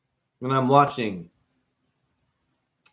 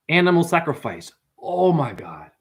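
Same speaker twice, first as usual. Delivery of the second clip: ordinary talk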